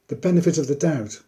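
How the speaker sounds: usual speaking voice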